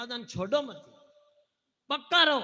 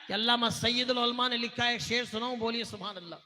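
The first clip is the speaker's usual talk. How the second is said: usual speaking voice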